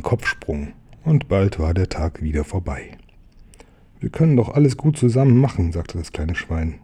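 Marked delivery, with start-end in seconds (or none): 4.0-5.7 quick coaxing pig. Acted.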